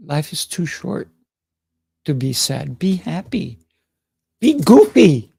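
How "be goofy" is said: high, loud playful shout; goofy cheer. Acted. Host urging viewers to loosen up, loud and high. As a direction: burst out with it like a big kid.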